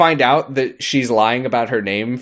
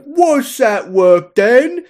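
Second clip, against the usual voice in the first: high, loud, very drawn-out barkeep; tavern owner; 12 semitones higher, 5 dB louder, much slower than usual. Acted. Tavern owner questioning departing barmaid, exaggerated put-on voice. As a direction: quiz her in a put-on barkeep voice. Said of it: "And he's like".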